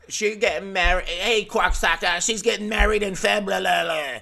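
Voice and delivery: loud, high Boston caricature, February mangled; thick Boston accent. Retold or imitated. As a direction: holler it in a cartoonish Boston accent. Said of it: "someone with a thick Boston accent say February".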